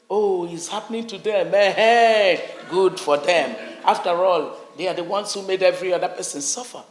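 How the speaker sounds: loud, mocking good-riddance voice; gloating mimic. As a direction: sneer it out like a spiteful onlooker. Others gloating over the Northeast.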